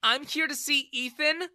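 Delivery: put-on high, loud, cross young woman; angry reply. A narrator's reading. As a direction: snap back at him angrily. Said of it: "she replied angrily".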